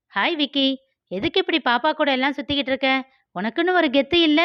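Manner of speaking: high, quick, mocking girl's voice; teasing girl Malar. Malar mocks Vicky on the street, high and teasing. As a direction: needle him like a cocky young woman.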